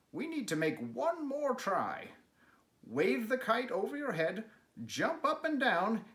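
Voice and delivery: high, bright-voiced encouraging frog; eager Frog.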